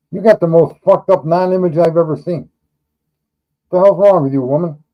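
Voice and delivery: loud disbelief at a baffling image; exasperated. Real, not acted. Exasperated at chat's profile picture.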